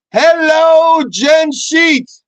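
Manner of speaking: high, loud drawn-out hello; shouted shout-out to a listener; calling out. Pretend.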